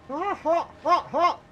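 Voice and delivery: high yelps through hot food; mouth full of hot food. Real, not acted. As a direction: mouth burning, gasping words.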